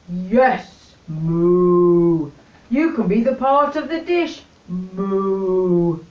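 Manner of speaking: lightly voiced cow with moos. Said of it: "said the cow".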